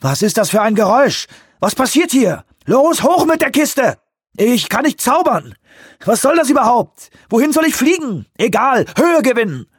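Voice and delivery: hohe, gehetzte Pilotenstimme voller Angst; überschlag dich vor Angst und Druck; panischer Pilot. Acted. Panischer Pilot im startenden Helikopter. (panischer Pilot, hoch und gehetzt)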